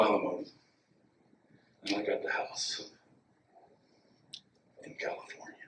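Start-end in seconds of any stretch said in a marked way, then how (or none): 1.8-5.5 sly whisper. Real, not acted. Gloating aside about getting the house.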